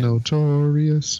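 slow, high, stretched Notorious; drawn-out echo. Retold or imitated. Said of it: "I'm notorious".

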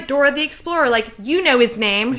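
put-on high, chirpy kids' show host; Dora the Explorer voice. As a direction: talk like a cartoon explorer to kids.

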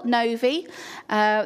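ordinary delivery

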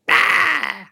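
very high dinosaur screech; imitated T-Rex squawk. T-Rex replying to a friendly dinosaur, high squawk. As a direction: answer with one long, high squawk. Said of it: "and he's like".

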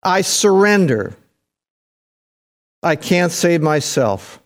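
drawn-out, heartfelt prayer of surrender; slow, impassioned giving up; surrendering to God. Shown as a demonstration. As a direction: cry it out high, then ease down slowly. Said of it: "You just say".

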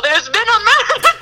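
hammed-up alarm at a dead body; mock murder-scene yell. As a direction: announce the murder like a stage mystery. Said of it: "I'm gonna yell".

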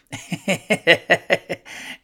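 real giggling fit; laughter. Real, not acted. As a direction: crack up at your own line. Cracks up at own joke.

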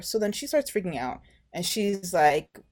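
normal talking voice

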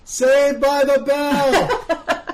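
excited laughing exclamation. Real, not acted. Realizing the answer, laughing.

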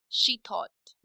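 ordinary delivery, nothing marked

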